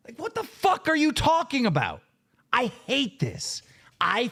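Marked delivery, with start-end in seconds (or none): none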